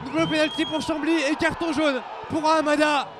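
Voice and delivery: shouted goal call; excited sports commentary. Real, not acted. Penalty call.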